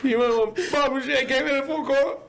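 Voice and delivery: very high, exaggerated girl impression; laughing high-pitched mimicry; mock college girl. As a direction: babble it in a high put-on girl voice. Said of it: "that guy that does the impressions".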